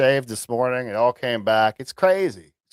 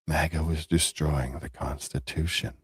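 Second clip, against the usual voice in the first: ordinary talk, nothing marked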